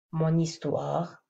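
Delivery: « mon histoire » lent, n lié; bien lier « mon histoire » lentement; slow liaison. Shown as a demonstration. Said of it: "On va voir quelques exemples". (liaison lente sur « mon histoire »)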